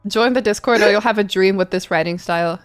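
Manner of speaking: ordinary delivery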